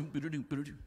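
low, soft galloping sounds; playful galloping noise; hoofbeat noises. Retold or imitated. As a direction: mimic a horse riding off. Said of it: "jump on my horse and do".

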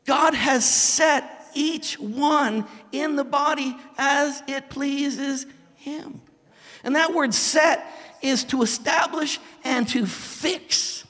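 very slow, weighty delivery; slow solemn scripture. Acted. Pastor dwelling on the verse for the congregation, very slow. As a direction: lay the verse down slowly.

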